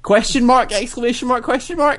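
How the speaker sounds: punctuation read out in high-pitched disbelief; incredulous. Retold or imitated. Storyteller spelling out the supervisor's astonishment, incredulous. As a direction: voice each mark as an astonished question. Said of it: "the sound of disbelief in his voice".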